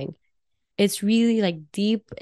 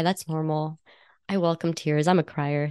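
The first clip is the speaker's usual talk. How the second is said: ordinary talk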